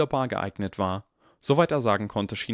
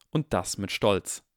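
plain reading voice

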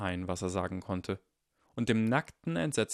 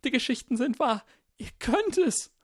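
very high, loud desperate insistence; 5 dB louder than usual. Acted. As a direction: cry it out high, clinging to belief. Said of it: "seine Stimme hoch und verzweifelt".

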